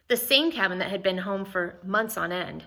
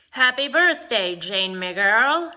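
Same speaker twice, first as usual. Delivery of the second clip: put-on a light Irish brogue, louder and slowed on "Jane, my girl"; lightly voiced Irish woman, sick but fond; thick Irish brogue; 3 semitones higher, 6 dB louder, slower than usual. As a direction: give the birthday wish a hint of Irish lilt. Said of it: "in her thick Irish brogue".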